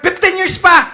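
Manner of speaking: loud, high booming mock divine reply; playful voice of God. Preacher voicing God adding years to the wait. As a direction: tease the impatient kid as God.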